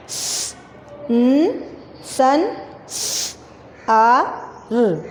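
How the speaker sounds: स, न, सन, स, आ, र sounded one by one; letter by letter for the class; spelling out letters. Shown as a demonstration. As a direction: break संसार into letters. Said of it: "संसार".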